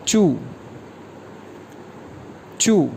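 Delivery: चू with a sharp falling tone; falling fourth tone. Shown as a demonstration. Teacher modelling fourth tone चू, sharp fall. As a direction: drop the pitch hard on चू. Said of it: "four tone के साथ चू".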